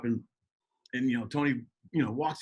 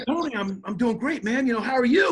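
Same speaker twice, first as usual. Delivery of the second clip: high, happy catch-up chatter; excited greeting; 9 semitones higher, 4 dB louder than usual. Retold or imitated. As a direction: beam at your buddy, all enthusiasm. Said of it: "so excited to see my brother".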